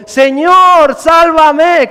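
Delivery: loud, high desperate shout; acted cry for help. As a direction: call out as if sinking. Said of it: "gritó".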